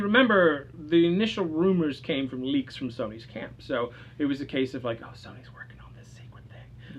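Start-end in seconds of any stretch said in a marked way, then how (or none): 5.0-7.0 whispered, like sharing a secret rumor; conspiratorial whisper. Retold or imitated. Cohost mimicking leaked rumors from Sony's camp, in a hushed whisper. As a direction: whisper it as if leaking insider news.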